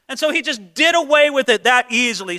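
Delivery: ordinary talk, nothing marked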